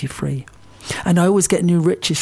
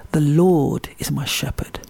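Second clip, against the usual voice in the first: "Lord" leaned on, slow line; stress on Lord. Shown as a demonstration. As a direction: dwell on the word "Lord". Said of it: "stressing the words in order".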